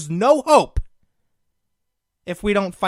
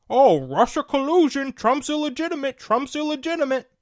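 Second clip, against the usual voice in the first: higher, whiny mock of opponents; mocking Democrats; 5 semitones higher than usual. Host mimicking Democrats complaining for years, mock whine. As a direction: parrot the other side with sneering scorn. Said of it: "And you Democrats for years".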